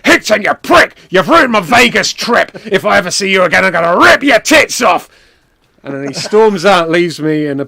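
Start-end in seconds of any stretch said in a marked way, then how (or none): 0.1-5.1 loud, high, furious shout; wrestler's angry threat. Retold or imitated. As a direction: bellow the threat with full force. Acts out wrestler's angry parting threat.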